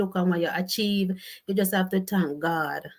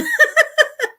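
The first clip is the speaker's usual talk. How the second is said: shrieking laugh; big high burst of laughter; laughing hard at the comment; 9 dB louder than usual. Real, not acted.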